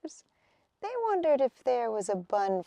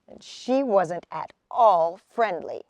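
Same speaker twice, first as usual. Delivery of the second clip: ordinary delivery, nothing marked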